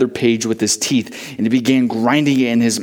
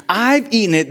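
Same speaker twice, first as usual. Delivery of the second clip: higher cry of wonder; ecstatic cry; 9 semitones higher than usual. A narrator's reading. Boy crying out to Shepherd after eating the page, ecstatic. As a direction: cry it out in rapture. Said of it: "the boy cried".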